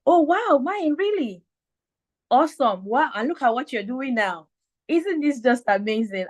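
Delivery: high, gushing wow reaction; amazed. Retold or imitated. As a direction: gasp in delighted disbelief. People's amazed reactions voiced.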